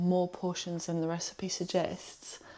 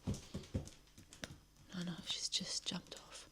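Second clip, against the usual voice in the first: soft hushed whisper; 10 dB quieter than usual. Real, not acted. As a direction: whisper so the pet stays calm. Whispering beside snuggled pet.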